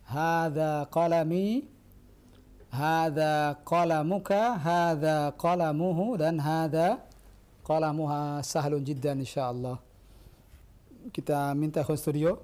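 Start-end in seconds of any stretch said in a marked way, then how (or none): 0.1-8.5 slow, careful model reading, each word drawn out. Shown as a demonstration. Teacher showing the class the pattern, slow and careful. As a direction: read it out slowly so the class can follow. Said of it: "disebutkan pada contoh".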